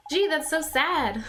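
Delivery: put-on gentle young Oliver, a little sad; lightly voiced young boy; boy hero voice.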